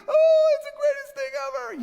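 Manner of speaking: high, loud, hammed-up crying joy; mock sobbing joy. Preacher mimicking scoring players, loud mock tearful joy. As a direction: blubber happily like a winning player. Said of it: "they'll start crying".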